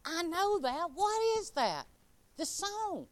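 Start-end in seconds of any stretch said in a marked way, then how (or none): 0.1-1.8 puzzled wondering. Retold or imitated. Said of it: "And I thought".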